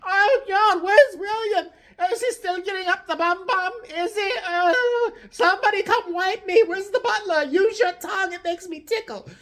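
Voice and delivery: put-on high, crackly old lady whining; hammed-up doddering old queen. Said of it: "and go".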